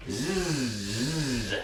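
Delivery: a soft, held buzzing Z; buzzed z. Shown as a demonstration. Teacher isolating the Z sound for students. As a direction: buzz the Z quietly on its own. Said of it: "teacher isolating the Z sound".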